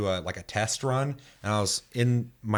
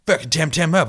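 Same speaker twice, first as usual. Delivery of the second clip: hostile trainer; 7 semitones higher, 6 dB louder than usual. Retold or imitated. Hostile trainer voiced in story.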